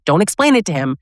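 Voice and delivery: ordinary talk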